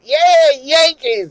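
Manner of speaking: high, loud taunting cheer; provocative cheer. Retold or imitated. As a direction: cheer on purpose to needle the room. Cheering in rival bar.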